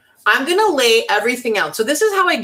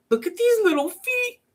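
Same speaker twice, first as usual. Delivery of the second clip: much higher, cutesy squeal; cooing over cuteness; 13 semitones higher than usual. Real, not acted. Crafter showing viewers the chicks' tiny feet, cutesy high coo. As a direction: gush over something adorable.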